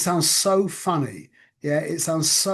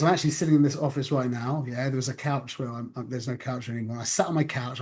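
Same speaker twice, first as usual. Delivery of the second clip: ordinary talk, nothing marked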